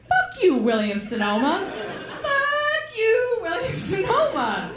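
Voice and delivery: high mock-angry cry; laughing outburst. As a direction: hurl it at the store through laughter. Comic outburst at the store.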